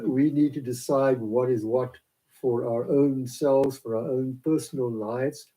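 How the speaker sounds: ordinary talk, nothing marked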